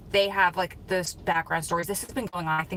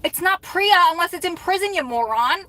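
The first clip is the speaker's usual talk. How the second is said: mock scolding; 10 semitones higher, 6 dB louder than usual. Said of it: "And then I was like".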